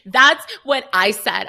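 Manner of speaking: loud, slow vindicated retort; retold exasperated emphasis; emphatic agreement. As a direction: land every word with sass.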